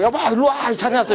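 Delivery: high, loud call to her husband across the subway car; loud, high auntie calling out, half laughing; bossy middle-aged wife. Retold or imitated. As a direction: summon him over like you own the seat.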